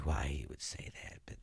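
hushed conspiratorial aside; whispering. Real, not acted. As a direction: drop to a whisper.

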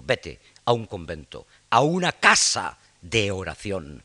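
slow, booming theatrical delivery; hammy stage actor. Acted. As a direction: declaim it slowly like a stage tragedian. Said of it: "Y se va oyendo".

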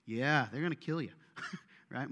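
normal talking voice

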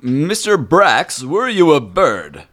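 high, loud and slow character voice; slow NPC voice. Acted. GM's character questions Brax, loud, high and slow. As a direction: draw it out high and grand.

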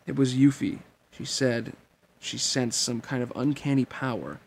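ordinary delivery, nothing marked

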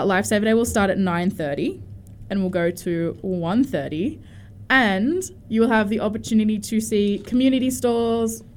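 ordinary talk, nothing marked

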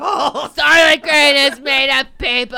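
slow, huge hammed-up shout; yelled movie quote. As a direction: bellow the film's big revelation, drawn out. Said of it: "Phil Hartman doing it".

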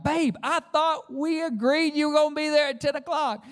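husband rehearsing the fight before she answers; rehearsed accusation. Retold or imitated. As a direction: practise the angry phrase in your head. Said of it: "I'm like".

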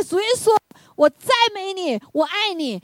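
high, loud, fervent prayer cry; the sister crying out praise over her coworker. Retold or imitated. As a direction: shout praise to Jesus with no care who hears. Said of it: "大声赞美神".

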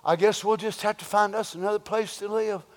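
understated mock of a pushover; meek surrender. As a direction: shrug it off meekly. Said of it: "we said".

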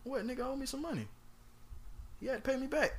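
usual speaking voice

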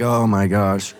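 low, loud, horrified oh my gosh; loud low mortified exclamation; wife's mortified gasp. Retold or imitated. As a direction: play the embarrassed wife. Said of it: "And my wife's like".

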